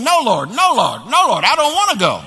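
loud, high, acted-out plea to God; pleading protest. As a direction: beg off in repeated swooping cries.

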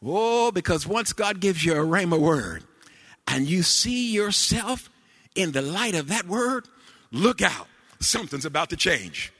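high, loud whooping sermon cadence; preaching. Acted. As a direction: build the congregation up with each line. Preaching at full force with organ.

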